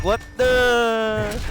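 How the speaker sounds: retold jaw-drop reaction; shocked disbelief. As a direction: gasp it out in disbelief. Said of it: "I'm like".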